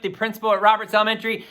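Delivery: ordinary delivery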